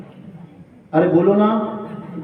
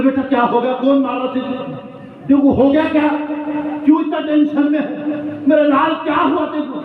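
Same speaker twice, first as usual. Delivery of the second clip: worried mother; 6 semitones higher than usual. Acted. Mother wakes and soothes her son.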